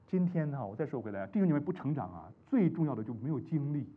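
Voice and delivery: usual speaking voice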